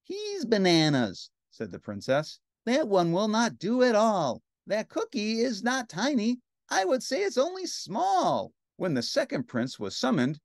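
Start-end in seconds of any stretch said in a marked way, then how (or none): 0.0-2.4 higher, slowed princess voice; dismissive princess. Acted. 2.6-8.5 high, haughty princess voice; picky princess. Acted. Princess rejects cookie.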